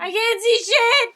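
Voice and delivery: loud, high angry yell; frustrated shout. Real, not acted. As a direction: shout it out in exasperation. Frustrated in dark game.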